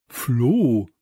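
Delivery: loud call of a name. A narrator's reading. Samson reacts to a friend's scream, calling out loudly. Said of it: "rief Samson".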